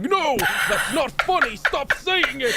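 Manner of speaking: high, hammed-up wailing protest; mock scream, drawn-out and very high; crying and screaming. [name] begging Merrick to stop, mock tearful screaming. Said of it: "crying and screaming".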